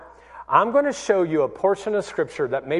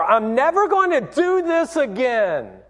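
emphatic vow; 6 semitones higher than usual. Retold or imitated. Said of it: "Have you ever told yourself before".

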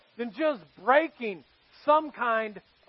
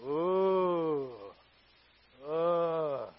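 low, quiet mock "ooh, ugh" of disgust; disgusted dentist groan; 7 semitones lower, 6 dB quieter than usual. Dentist peering in your mouth, low disgusted groan. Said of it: "they're going".

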